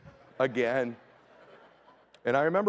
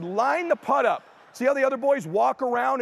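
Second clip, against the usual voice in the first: coaching call; 9 semitones higher, 5 dB louder than usual. Retold or imitated. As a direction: stretch each word like a stern coach. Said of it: "I said".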